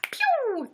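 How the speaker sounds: quick high zap noise; high pew sound effect. Pretend. Celebrating the likes count with a high zap sound. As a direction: fire off a little laser pew.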